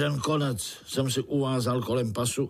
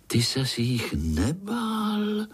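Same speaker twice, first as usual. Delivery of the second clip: high, slow, wide-eyed question; curious little spider; 9 semitones higher, slower than usual. A narrator's reading. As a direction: butt in with a drawn-out childlike question. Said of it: "No samozřejmě Pája". (zvídavý pavouček skáče do řeči)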